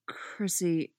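soft, careful address; quiet, tentative opening. A narrator's reading. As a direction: say the name gingerly. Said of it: "he says tentatively".

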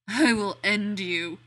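slow, loud interrogator growl; icy polite interrogator. Acted. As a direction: threaten like a mock interrogator. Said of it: "Danny growls, in the best interrogator voice".

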